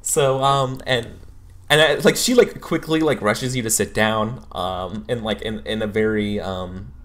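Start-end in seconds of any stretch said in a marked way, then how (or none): none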